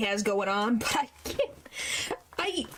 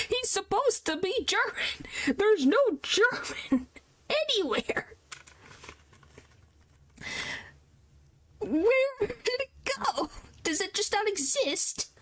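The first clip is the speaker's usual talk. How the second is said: high-pitched, exasperated disbelief; incredulous protest; 8 semitones higher than usual. Real, not acted. Streamer protesting the missing German accent, incredulous. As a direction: pitch it up high, pleading for an explanation.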